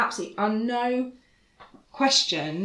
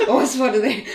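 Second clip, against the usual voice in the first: higher, louder hammed-up weariness; tired voice; 5 semitones higher, 7 dB louder, faster than usual. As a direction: replay the weary voice through a laugh. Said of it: "tired voice that you can do".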